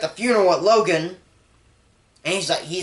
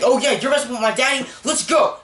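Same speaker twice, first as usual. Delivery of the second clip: put-on quick, high, pumped-up kid voice; excited imitated cheer, racing and high; hyped-up imitating; 7 semitones higher, faster than usual. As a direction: egg her on, fast and much higher. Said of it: "and he's like".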